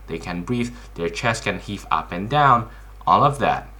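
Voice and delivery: ordinary delivery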